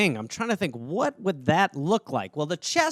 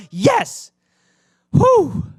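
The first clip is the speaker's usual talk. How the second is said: high, loud hammed-up yell and exhale; mock emotional outburst; 7 dB louder than usual. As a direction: overblown burst of Christmas passion. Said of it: "I get so emotional about Christmas".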